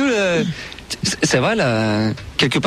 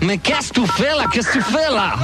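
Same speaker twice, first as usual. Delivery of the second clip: higher, exasperated outcry; 5 semitones higher than usual. Real, not acted. Host to the grandmother wrecking her prize call, exasperated. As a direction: despair at someone botching it.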